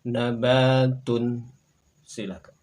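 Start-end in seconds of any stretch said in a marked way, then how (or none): 0.1-1.4 "Nabatun" very slow and loud, ending in -tun; model Arabic reading. Shown as a demonstration. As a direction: stretch out "Nabatun" for students to copy. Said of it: "Berikutnya silakan". ("Nabatun" dibaca sangat pelan dan lantang)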